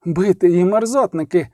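usual speaking voice

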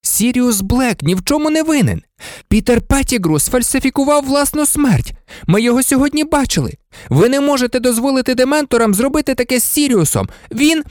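high, fast, urgent protest; breathless-paced pleading at a high pitch; desperate boy. Acted. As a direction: plead a friend's innocence in a rush.

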